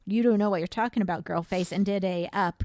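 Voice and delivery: usual speaking voice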